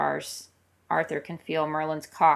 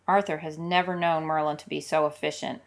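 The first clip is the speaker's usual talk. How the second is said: ordinary delivery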